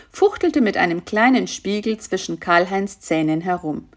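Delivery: ordinary delivery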